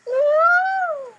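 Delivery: high loud whoop of delight; delighted woo; excited whoop. Real, not acted. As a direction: cheer it out. Excited cheer at threesome idea.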